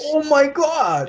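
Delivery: slow, high Oh my God in disbelief; shocked. Real, not acted. Friend hearing about a coral snake nearby, slow shocked. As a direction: stretch it out, really shaken by the news.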